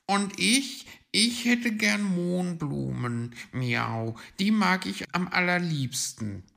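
high, loud cat voice with a meow; cat figure. Acted. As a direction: declare it as a keen cat.

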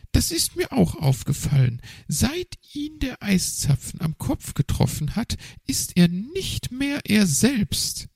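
high, nervous magpie voice. A narrator's reading. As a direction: play the magpie up high and hesitant.

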